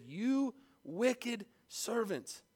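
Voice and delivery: slow, heavy rebuke of a servant; stern master. Acted. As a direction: drag out the condemnation, slow and grave. Master condemns servant in parable.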